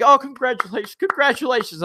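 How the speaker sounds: high, mock-cheery congratulations; hammed-up sarcastic cheer. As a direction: fake praise with an eye-roll in the voice. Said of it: "And you're like".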